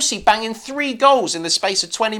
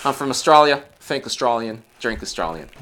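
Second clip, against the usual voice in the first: put-on Australian accent; 8 semitones lower than usual. Finishing the accent round, low Australian accent. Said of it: "on the Australian".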